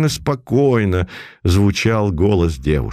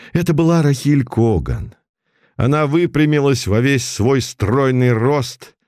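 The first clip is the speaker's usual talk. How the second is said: ordinary delivery, nothing marked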